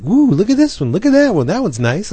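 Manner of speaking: high-pitched excited cooing; mock wide-eyed enthusiasm; imitated gushing. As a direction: swoop up high, mimicking an easily dazzled friend. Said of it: "And Jason's like".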